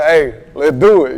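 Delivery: loud, slow, pumped-up go-ahead; hyped. Retold or imitated. Rapper hyping himself to hit the studio, loud and slow. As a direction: fire yourself up to record.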